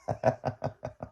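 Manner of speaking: breathy, quiet laugh; soft wheezy chuckle; laughter. Real, not acted. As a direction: laugh helplessly at your own story. Laughs at his own story.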